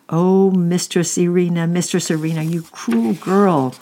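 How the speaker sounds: put-on high, loud, breathy shriek at the girl; shrieking nurse. A narrator's reading. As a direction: let the nurse shriek in alarm. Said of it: "shrieked the nurse".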